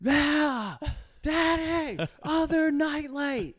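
put-on very high, drawn-out kid yell; yelling little daughter. Daughter yells from bed for her night light, mock yelling. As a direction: imitate a child calling loudly from bed. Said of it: "and she yells".

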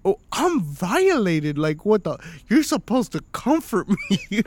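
hammed-up wounded protest; mock indignant. Acted wounded protest to wife.

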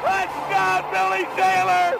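ordinary talk

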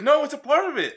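high, loud, laughing imitation; mock-exasperated explaining; retold insistence. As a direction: imitate an impatient partner insisting.

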